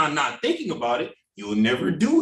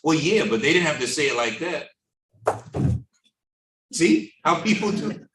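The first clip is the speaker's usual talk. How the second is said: ordinary talk, nothing marked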